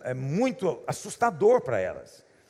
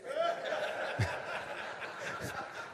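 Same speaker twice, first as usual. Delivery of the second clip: breathy laugh; 10 semitones higher, 11 dB quieter than usual. Real, not acted. Laughs at his own story.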